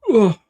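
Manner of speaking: a groaning yawn; real yawn. Real, not acted. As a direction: let out a tired yawn. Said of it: "Sorry, I'm yawning".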